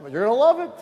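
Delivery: welcoming host answering the doubting friend, eager and loud; eager reply. Retold or imitated. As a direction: sell it with a loud grin.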